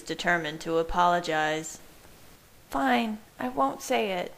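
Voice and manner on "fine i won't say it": high, lightly garbled voice; higher, as if lips are held. A narrator's reading. Bella gives in with lips held, slightly garbled. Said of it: "Her voice was garbled".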